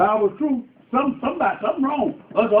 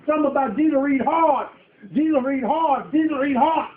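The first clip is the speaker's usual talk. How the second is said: rising preaching; 6 semitones higher than usual. Acted. Preacher building intensity.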